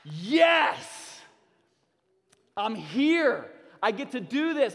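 high, loud cry of relief; joyful outburst. Retold or imitated. Father re-imagining life with his kids, shouting for joy. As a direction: burst out with joy at still being alive. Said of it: "and I'm like".

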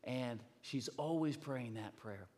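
normal talking voice

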